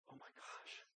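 stunned whisper; hushed shock. Retold or imitated. Preacher recalling a room stunned by staff stories, hushed whisper. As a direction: barely breathe it, awestruck.

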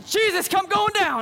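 high-pitched desperate call for help; acted drowning panic; panicked cry. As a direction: cry out as you go under. Sinking Peter cries for help.

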